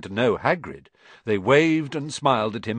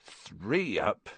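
soft, slowing count to himself; muttering; 3 semitones lower, 6 dB quieter, much slower than usual. A narrator's reading. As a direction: count under the breath, absorbed. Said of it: "he muttered".

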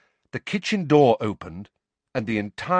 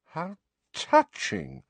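high, slow, softened sneer; Snape, sneering; 10 semitones higher, slower than usual. A narrator's reading. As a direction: sneer it slowly with mock sympathy. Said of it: "Snape sneered".

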